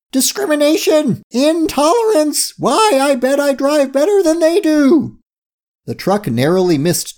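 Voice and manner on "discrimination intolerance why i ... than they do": very high, indignant squealing rage; shouting in rage. A narrator's reading. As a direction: shout it in hammed-up outrage. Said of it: "Curie shouted in rage".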